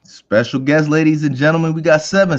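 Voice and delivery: loud, high game-show announcer welcome; hype announcer intro. Real, not acted. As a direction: introduce the guest like a hype man. Hosts introducing a guest.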